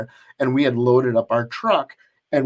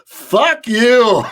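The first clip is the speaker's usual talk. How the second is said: sudden loud, higher retort; a blunt, laughing fire-back; mock shout; 8 semitones higher, 7 dB louder than usual. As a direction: bark it right back at him. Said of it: "looks over at him and he goes".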